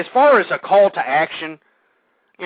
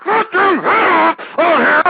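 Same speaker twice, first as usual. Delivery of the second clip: much higher and louder, slow furious yell; screaming; 9 semitones higher, 6 dB louder, slower than usual. Retold or imitated. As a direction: scream it out at the world, dragging the words. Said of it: "I'm the only one screaming like".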